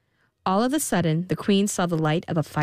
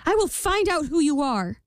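higher, earnest queen voice; pleading queen; 10 semitones higher than usual. A narrator's reading. Queen bargaining with the imp, higher pleading. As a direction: offer it like a worried mother. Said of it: "said the queen".

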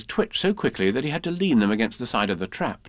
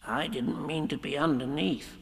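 sadly; 3 semitones higher, 6 dB quieter than usual. Acted. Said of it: "said Piglet sadly".

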